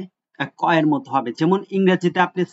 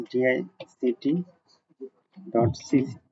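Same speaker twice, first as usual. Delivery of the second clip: spelled out slowly; 3 semitones lower, 6 dB quieter, much slower than usual. Shown as a demonstration. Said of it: "আমি দেখাচ্ছি".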